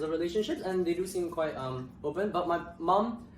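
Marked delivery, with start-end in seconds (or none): none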